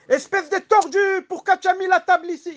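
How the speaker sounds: husband stubs his toe and yells at his wife; angry yell at wife. Acted. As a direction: blame the wife at the top of your voice. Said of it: "Il va crier sa femme".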